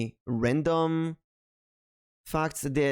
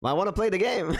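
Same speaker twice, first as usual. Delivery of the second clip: higher-pitched mock pleading; playful protest; 7 semitones higher, much faster than usual. Streamer playfully protests advice to wait, mock pleading. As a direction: insist like an eager kid, playfully.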